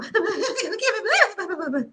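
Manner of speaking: high-pitched blubbering fake cry; hammed-up gibberish sobbing; mock bawling. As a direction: wail and babble through pretend tears. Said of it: "we both about to be crying".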